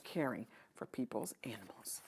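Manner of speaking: soft unvoiced close; whispered. A narrator's reading. Narrator ending the sad scene, whispering. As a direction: let it fade to a whisper.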